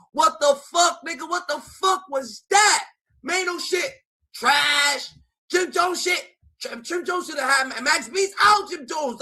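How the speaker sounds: loud, high-pitched outraged rant; outraged shouting. Real, not acted. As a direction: yell it out in disbelief. Ranting at Fab's diss track.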